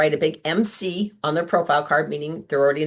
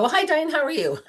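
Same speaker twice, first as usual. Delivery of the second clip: much higher, sing-song welcome of a happy client; delighted customer; 11 semitones higher than usual. Retold or imitated. Longtime customer greeting her consultant, imitated delight. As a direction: gush like a fond old customer. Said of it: "They go".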